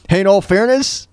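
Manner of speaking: ordinary delivery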